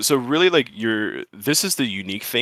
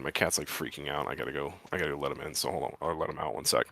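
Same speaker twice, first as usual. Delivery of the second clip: much softer, off-mic aside about the cat; stepping away from mic; 6 semitones lower, 11 dB quieter than usual. Real, not acted. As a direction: drift away from the mic and mutter to yourself. Steps away to handle cat.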